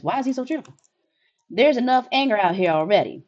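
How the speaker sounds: ordinary delivery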